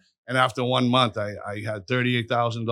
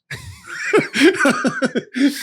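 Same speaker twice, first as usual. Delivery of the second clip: burst of laughter; 6 dB louder than usual. Real, not acted. His laugh at his own story.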